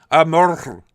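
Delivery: ordinary delivery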